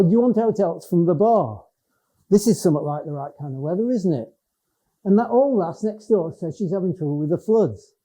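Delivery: informal Lake District pub talk; Cumbrian dialect. Shown as a demonstration. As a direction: chat broadly with your Cumbrian mates. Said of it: "I'd speak like that when I'm with them".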